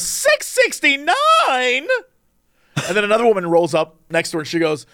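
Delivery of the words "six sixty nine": high, slow, stretched-out outraged question; shocked disbelief. Retold or imitated. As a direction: drag out the price in horror. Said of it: "And the woman goes".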